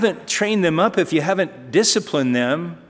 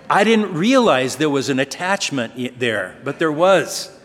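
usual speaking voice

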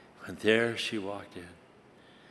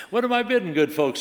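higher, louder auctioneer call; auctioneer's cry; 7 semitones higher, 4 dB louder than usual. Acted. Auctioneer calls to the crowd for a first bid, loud cry. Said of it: "he cried".

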